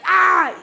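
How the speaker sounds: loud, high mock scream; acted cry of pain. Danzo as his arm is taken, crying out. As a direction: cry out as if just wounded. Danzo cries out in retold story.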